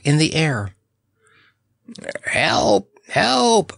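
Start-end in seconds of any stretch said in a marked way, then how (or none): 2.3-3.7 high, frantic cries for help; hammed-up bird crying help; frantic croak. A narrator's reading. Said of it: "croaked Snorer".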